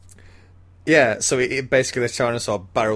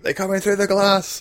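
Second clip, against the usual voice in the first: high-pitched mock warning cry; urgent acted shout, pitched high; imitated shout; 10 semitones higher than usual. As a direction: call out the alarm, imitating the film. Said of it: "you hear Ellie shout".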